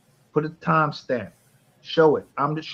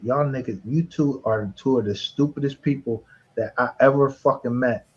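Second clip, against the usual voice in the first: usual speaking voice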